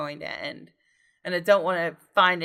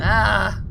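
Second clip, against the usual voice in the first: startled yelp then laugh. Real, not acted. Jump scare in the game.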